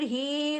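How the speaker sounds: ही stretched as long as a madd; wrongly lengthened. Shown as a demonstration. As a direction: overlong ee on ही. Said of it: "इतना लंबा नहीं कर देना". (ही को गलत, लंबा खींचकर)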